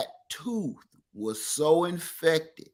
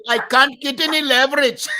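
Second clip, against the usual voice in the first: imitating an Indian accent, higher and louder; mock Indian accent, raised pitch; 8 semitones higher, 6 dB louder than usual. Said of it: "he kept saying in an Indian accent".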